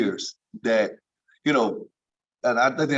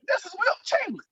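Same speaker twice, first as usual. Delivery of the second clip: much higher, awestruck voice with no bass; starstruck squeak. Retold or imitated. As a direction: gasp it out like a starstruck rookie. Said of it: "he changed your whole voice".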